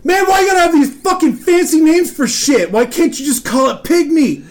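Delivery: high, indignant mock rant; exasperated outburst. Coworker learning what pork is, retold exasperated rant. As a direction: imitate a coworker's comic tirade, fast then exploding.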